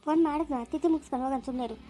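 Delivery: ordinary talk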